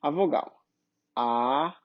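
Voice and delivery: A held long, low in pitch; vowel A demonstrated. Shown as a demonstration. Teacher showing Portuguese vowels, A sounded out long. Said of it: "a gente tem, por exemplo".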